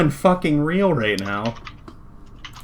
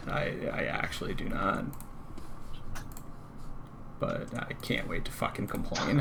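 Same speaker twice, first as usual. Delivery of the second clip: hushed, breathy grumbling under the breath; seething quietly to himself; muttered complaint; 4 semitones lower, 15 dB quieter than usual. Real, not acted. Streamer muttering about the broken plugin, hushed.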